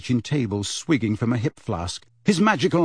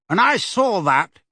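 louder, higher irritated snap; irritable; 6 semitones higher, 4 dB louder than usual. Acted. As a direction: catch her out, annoyed. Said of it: "he added irritably".

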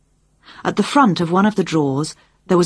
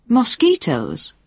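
MOSQUITOES drawn out slowly as a higher-pitched question; querying; 5 semitones higher, slower than usual. A narrator's reading. Jane peering into a buzzing cage, querying "Mosquitoes" slowly, higher. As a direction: stretch "Mosquitoes" into a puzzled query. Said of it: "she queried".

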